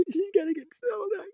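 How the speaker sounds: high, choked crying voice. Real, not acted. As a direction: voice breaking into tears over the lost fox. Distressed over lost pet.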